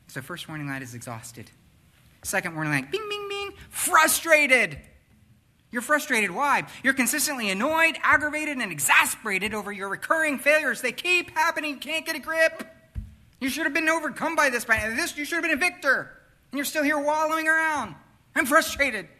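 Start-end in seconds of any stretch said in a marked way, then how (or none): 2.9-3.5 high, pinging imitation of a dashboard chime; three quick pings like a warning light; warning chime bings. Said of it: "comes with those annoying little sounds". 3.7-19.1 high, loud, exasperated preaching at full force; frustrated preaching. Acted. Preaching the frustration warning light.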